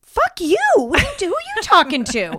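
high, loud retold outburst; starts high and loud, then eases; mock angry comeback. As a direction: fire back indignantly, imitating Dan.

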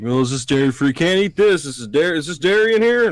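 high, loud fussy complaint; fussy aunt voice. Retold or imitated. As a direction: mimic the picky aunt. Fussy aunt voiced about dairy.

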